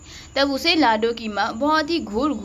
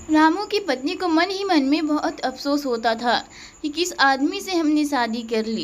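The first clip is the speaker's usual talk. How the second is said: usual speaking voice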